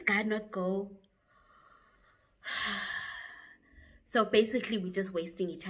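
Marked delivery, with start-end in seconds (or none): 2.4-3.3 long, soft breathy sigh; exasperated sigh. Retold or imitated. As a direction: let out a weary exhale. Exasperated pause in her story.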